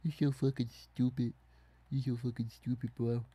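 put-on childish playground taunt at the opponent; sing-song mocking jeer. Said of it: "Gonna say".

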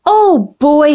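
usual speaking voice

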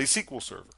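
"S Q L" said SEE-kwul; "S Q L" as one word, sequel; SQL as a word. Shown as a demonstration. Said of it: "I say".

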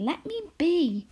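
high, slow, pleading cry; exclaimed plea. A narrator's reading. A daughter begs her mother to let her be. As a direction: stretch it out, begging to be left alone. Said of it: "exclaimed Arrietty".

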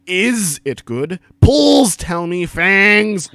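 loud, slow, drawn-out words; mockingly overdone, stretched and loud; hammed-up author's note. A narrator's reading. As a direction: belt it out high and overblown.

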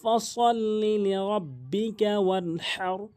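slow recited verse with stretched syllables; measured, drawn-out chant; Quran recitation. Acted. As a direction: recite slowly and carefully in tajweed.

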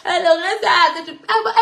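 high-pitched mimicry of delighted critics; mock gloating. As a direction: put on gleeful voices, pitched well up. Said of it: "ceux qui étaient contents pour dire".

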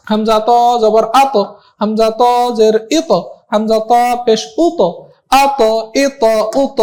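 slow spelling drill, আত, ইত, উত each with a bounced final t; measured chant-like drill with a kick after each final t; qalqalah bounce. Shown as a demonstration. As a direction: push the t at the end of আত, ইত, উত so it echoes. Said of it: "একটু ধাক্কা দিয়ে পড়া".

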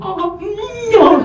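high squeaked pained whimper; a suppressed high yelp of pain; strained high whimper. Retold or imitated. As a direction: squeak out the discomfort. Doctor's finger, voiced discomfort.

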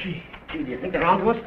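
alarmed, jittery question; worried they've been found out; nervous. Acted. Crook fears police are onto them.

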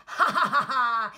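loud, showy "ha ha ha"; excited gloating laugh. Acted. Horse excited on race day, loud gloating laugh. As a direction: laugh out loud with cocky glee. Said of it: "the horse was very excited".

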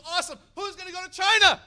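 high, loud triumphant question; rallying call. Retold or imitated. Preacher asking the leaders, loud triumphant question. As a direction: build to the big ask. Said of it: "He goes".

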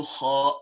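kha from the top of the throat; tajweed letter kha. Shown as a demonstration. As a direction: breathe kha out from the upper throat. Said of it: "and number two".

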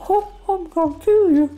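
very high, loud squeaky cartoon mouse; Mickey Mouse voice. Shown as a demonstration. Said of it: "from Mickey Mouse voice".

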